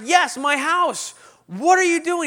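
loud, high mock outburst; hammed-up indignation; exasperated retort. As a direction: snap back, fed up. Retold fending off greeter.

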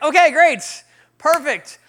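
big punchy "great"; relieved delight. Retold or imitated. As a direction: exclaim it like it's all worked out. Relieved reaction at finding church.

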